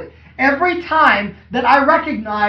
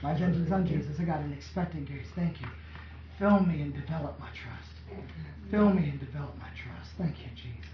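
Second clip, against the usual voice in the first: low, soft prayer to Jesus; quiet prayer; 8 semitones lower, 13 dB quieter than usual. Acted. Preacher voices a believer refocusing on Jesus, quiet prayer. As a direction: murmur it low, as a trusting woman praying. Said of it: "She's like".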